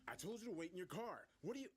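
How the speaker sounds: officer scolding. A narrator's reading. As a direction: scold someone who disobeyed, urgently. Officer grabs him, scolding.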